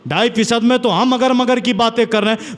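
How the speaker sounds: ordinary talk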